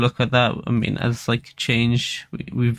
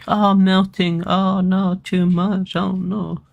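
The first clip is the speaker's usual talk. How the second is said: high, louder mock wail; screaming baby; 7 semitones higher, 4 dB louder, slower than usual. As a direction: whine it out like a screaming baby, mocking. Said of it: "this little baby screaming".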